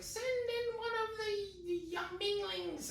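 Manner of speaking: high, slow regal princess; haughty high princess voice. Retold or imitated.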